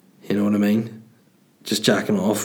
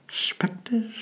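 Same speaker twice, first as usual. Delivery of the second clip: mock-spooky whispered warning; eerie whisper; 12 semitones higher, 8 dB quieter than usual. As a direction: whisper it like an ominous neighbour. Repeats neighbour's eerie warning.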